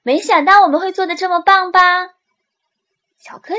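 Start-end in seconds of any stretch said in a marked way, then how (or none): 0.0-1.8 fast, high and loud boast; bursting with pride; proud excitement. Acted. Proudly showing off the work.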